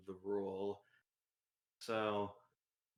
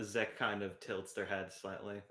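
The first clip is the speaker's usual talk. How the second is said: usual speaking voice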